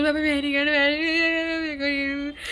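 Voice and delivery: whiny, drawn-out plea to dad; hammed-up slow crying whine; mock crying. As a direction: imitate a child's tearful wail, dragging the last words. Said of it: "मैं रोने लग गई".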